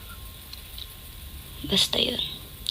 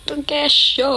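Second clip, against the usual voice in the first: calling out nickname; 6 semitones higher, slower than usual. Shown as a demonstration. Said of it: "Mga ganun ako".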